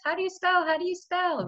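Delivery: high, repeated nagging question; whiny kid asking. Retold or imitated. Teacher imitating a child nagging family, whiny. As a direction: nag like a kid who can't spell. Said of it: "ask my family every two minutes".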